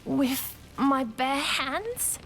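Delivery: incredulous question. Acted. Servant questioning the High Priest's order to clear sand, incredulous. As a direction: question the order in dismay, rising at the end.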